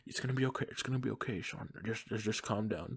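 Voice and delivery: put-on calm the sick kid down gently; soothing uncle. Uncle calming his sick nephew, soft and soothing. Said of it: "my Uncle Bob was like".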